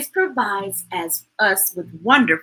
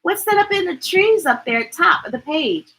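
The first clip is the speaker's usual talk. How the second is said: usual speaking voice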